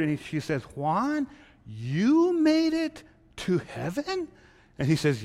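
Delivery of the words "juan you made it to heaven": much higher, slow disbelieving rise; can't believe he got in; astonished wife. Acted. Chelo astonished Juan is in heaven.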